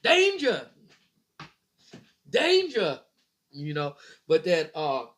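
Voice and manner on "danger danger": high, loud hammed-up warning cry; mock yell. Streamer imitating the wife's danger call, loud and high. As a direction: sound the alarm over a dirty diaper. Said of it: "You go".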